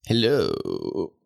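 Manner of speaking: mock-creepy greeting, lightly put on; drawn-out hello with a hint of creep; copied creepy hello. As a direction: copy a creepy uncle's hello. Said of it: "He did it first".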